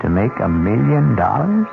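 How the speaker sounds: ordinary delivery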